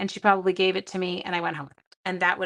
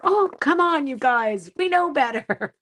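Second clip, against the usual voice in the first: high, loud teasing scold; mock exasperated teacher; 6 semitones higher, 4 dB louder than usual. Teacher scolding grade seven boys, higher and louder. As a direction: chide the class playfully.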